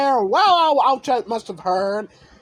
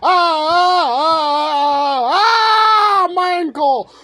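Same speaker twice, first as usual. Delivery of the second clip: high, loud pained cries building to a shout; a hurt girl screaming about her ankle; 6 semitones higher, 5 dB louder, much slower than usual. Acted. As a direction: cry out as if the ankle just snapped. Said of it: "She screamed out in pain".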